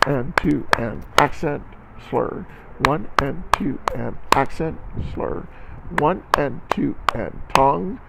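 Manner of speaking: rhythmic counting for a music class; counting aloud in rhythm. Shown as a demonstration. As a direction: count the beats out steadily, teacher style. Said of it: "clap with me".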